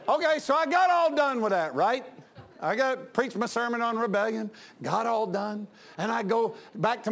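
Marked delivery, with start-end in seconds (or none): none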